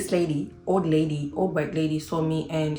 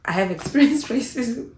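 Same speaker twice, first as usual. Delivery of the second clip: higher, laughing through experienced; a caught-out laughing confession; laughing admission; 6 semitones higher, 3 dB louder than usual. Real, not acted. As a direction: break into a laugh as the truth slips out. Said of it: "I told you I didn't plan this thing".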